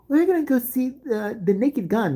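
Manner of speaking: high-pitched imitation of a kid; eager kid. Classmate announces the movie outing, high mock-kid voice.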